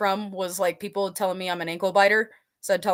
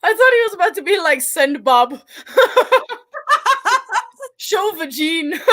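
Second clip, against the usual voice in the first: words squeezed out through loud high laughter; barely getting it out through the giggles; laughing hard; 8 semitones higher, 7 dB louder than usual. Real, not acted. Cracking up at a viewer comment, loud, high and laughing.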